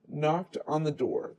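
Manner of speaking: ordinary delivery, nothing marked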